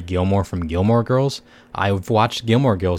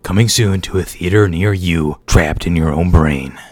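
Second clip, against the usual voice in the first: booming trailer narrator, louder and slowed; movie trailer voice; 2 semitones lower, 5 dB louder than usual. Pretend. Spoofing a movie trailer for his brain story, booming. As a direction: boom it like a film trailer announcer. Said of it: "that was pretty freaking weird".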